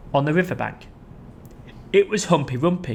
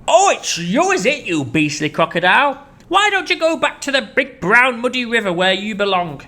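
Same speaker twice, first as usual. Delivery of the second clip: much higher, louder scolding; angry elephant; 7 semitones higher, 4 dB louder than usual. Acted. Chunky sending the crocodile home. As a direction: scold him off.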